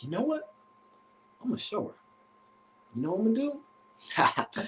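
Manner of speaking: hushed, trying to keep a lid on it; quietly plotting to himself; acted forced calm. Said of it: "Trying to calm down".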